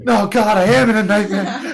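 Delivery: loud, high laughing wail; laughing exclaim. Real, not acted. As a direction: cry out through laughter. Laughing despair at game scene.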